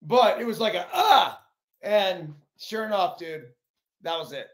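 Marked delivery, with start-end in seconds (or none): 0.9-1.4 sharp loud high "Ah!"; a quick yell to fake a punch; mock lunge shout. Rendon faking a jab at the fan, sharp shout.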